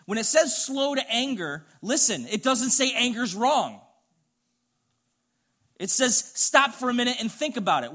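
loud, high, impassioned sermon delivery; fiery preaching. Acted. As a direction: preach it hard, driving every line home. Preaching at full force.

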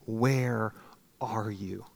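from a whisper to a desperate question; anguished plea. Retold or imitated. Man after a panic attack asking God, rising into an anguished plea. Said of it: "I just remember thinking".

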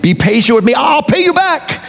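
high plea, climbing and stretched at the end; begging. A narrator's reading. Fellow servant on his knees to his creditor, begging. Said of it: "fell to his knees and begged him".

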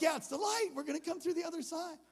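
high, loud rush of relief; excited confirmation. Retold or imitated. Answering the kid near the exit, excited. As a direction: cheer him on to the exit.

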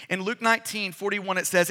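usual speaking voice

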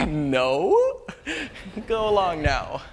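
hammed-up nervous brush-off; dismissive denial. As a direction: shoo a child away. [name] nervously brushes off girl.

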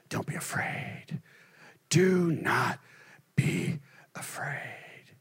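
slow, soft whispered comfort; gentle whisper. Acted. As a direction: whisper it like calming a frightened man. God's words voiced gently.

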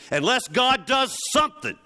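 sudden high, loud plea, each line climbing; cry out. Real, not acted. Preacher to congregation, crying out loud. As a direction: throw it up to the back pew.

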